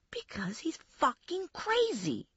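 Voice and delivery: hissed, unvoiced curse; angry whisper. Spiro telling Stephanie about Kenny, whispered. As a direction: whisper it like a secret threat.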